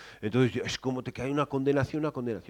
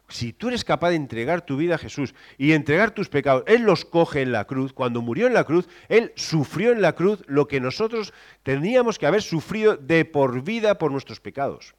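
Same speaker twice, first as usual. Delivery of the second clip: ordinary talk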